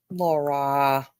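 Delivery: low, heavy reproach; disappointed call. Real, not acted. Reproaching Laura, low and let down. Scolding a viewer by name.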